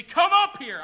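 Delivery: very loud, high battle summons; commander's shout. Acted. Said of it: "going to shout".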